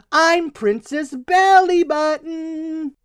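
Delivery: high, slow, grand princess voice; princess announcement. Acted. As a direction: announce yourself like royalty. Sister announces hero name.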